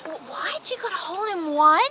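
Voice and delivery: retold child's astonished question; imitating excited little girl. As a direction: gasp it like an amazed child. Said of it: "and she's like".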